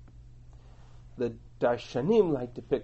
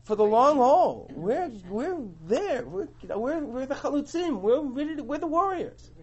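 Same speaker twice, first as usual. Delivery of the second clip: much higher, pleading and earnest; eager tribes pleading; 10 semitones higher than usual. Retold or imitated. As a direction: reassure Moshe, we're in for the long haul. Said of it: "what the response is".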